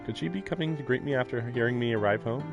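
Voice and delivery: normal talking voice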